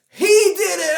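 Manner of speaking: high, loud mock of kids pointing the finger; kids accusing. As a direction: shout the blame like a crowd of kids. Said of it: "they said".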